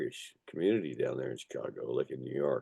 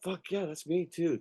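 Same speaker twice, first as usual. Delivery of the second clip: retold quote, pitched much higher; higher mock-enthusiastic agreement; excited quote; 13 semitones higher than usual. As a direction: toss off the quote in a higher, eager voice. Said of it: "I got the quote. It's like".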